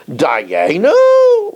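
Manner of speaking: Dayenu? said as a doubting question, not a declaration; Dayenu? voiced as a skeptical question; read as a question. Shown as a demonstration. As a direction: ask Dayenu? in wonder, would it be enough. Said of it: "really it should be read Bitmiya".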